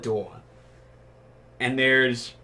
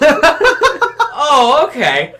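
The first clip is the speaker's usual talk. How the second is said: laughing; 13 semitones higher, 9 dB louder, much slower than usual. Retold or imitated. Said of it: "And I'm just like".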